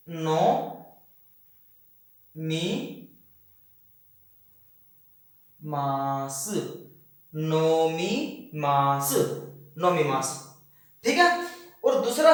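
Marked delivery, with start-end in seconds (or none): 0.1-6.5 नो, मी, मास split with long pauses; slowly, one syllable at a time; dictating each syllable. Shown as a demonstration. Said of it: "यानी कि". 7.4-9.1 नोमी मास stretched very slowly; teacher spelling it out slowly; slow careful. Shown as a demonstration. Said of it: "यानी कि".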